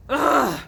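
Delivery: loud disgusted groan. A narrator's reading. Tom groaning on his back, loud disgusted groan. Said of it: "Tom lay down ... said".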